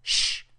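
sharp, sudden hush; urgent shush. A narrator's reading. Tom grabs Huck's arm on hearing something, urgent shush. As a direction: shush your friend suddenly. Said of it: "afferrò il braccio del compagno e disse".

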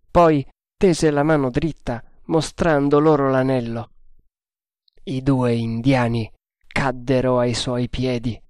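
ordinary delivery, nothing marked

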